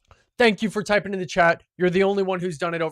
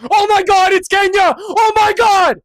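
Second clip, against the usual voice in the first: high, full-volume shriek of joy; real shouted jubilation; ecstatic screaming; 12 semitones higher, 11 dB louder than usual. Real, not acted. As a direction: scream it like you just won. Correct guess of Kenya.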